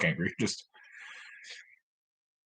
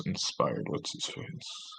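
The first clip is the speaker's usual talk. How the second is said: whispered; 15 dB quieter, slower than usual. Real, not acted. Struggling to recall a name.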